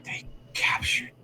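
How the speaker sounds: slow, broken whisper with long gaps; last breath. Acted. Dying captive warns rescuers, whispering with last breath. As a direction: spend your final breath on each phrase. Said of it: "with kind of a last breath".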